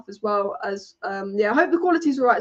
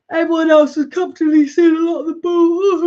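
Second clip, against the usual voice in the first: talking through a yawn; stretched yawning voice; 5 semitones higher, 8 dB louder than usual. Real, not acted. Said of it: "I'm yawning".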